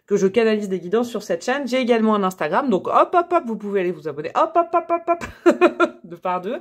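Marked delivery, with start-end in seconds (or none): 2.9-3.4 hop enjoué. Real, not acted. Scande des hop en montrant l'Instagram. 4.4-5.5 high, rhythmic playful "hop" chant; petits hop enjoués. Real, not acted. Scande des hop pour l'abonnement.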